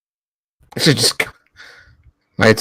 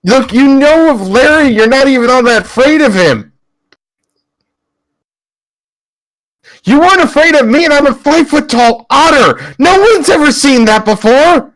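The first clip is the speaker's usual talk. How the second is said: much higher, urgent rallying voice; rally a scared friend, pitched way up; impassioned in-character pep talk; 10 semitones higher than usual. Acted. Five-foot-tall otter rallying a friend, high impassioned voice.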